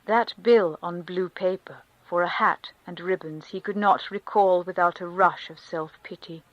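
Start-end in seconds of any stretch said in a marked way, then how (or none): none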